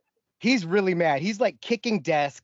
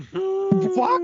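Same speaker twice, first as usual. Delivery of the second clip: high, loud mock-outraged yelp; outraged disbelief; 4 dB louder than usual. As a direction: squeal it in disbelief. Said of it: "I'm like".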